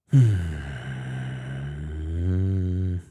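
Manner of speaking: long weary sigh; heavy fed-up sigh. Real, not acted. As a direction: let out a long sigh of frustration. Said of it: "I'm just pissed off".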